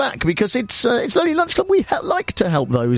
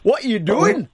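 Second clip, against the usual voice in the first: attempted Geordie accent; 8 semitones higher than usual. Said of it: "I can't really do the accent".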